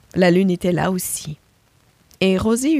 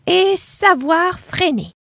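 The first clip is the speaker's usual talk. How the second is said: much higher, slow "freiner"; emphatic ending; 10 semitones higher, slower than usual. Acted. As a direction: stretch out the last point high. New lesson learned.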